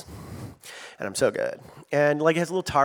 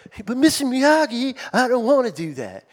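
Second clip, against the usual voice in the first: put-on whiny kid; 8 semitones higher than usual. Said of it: "he's like".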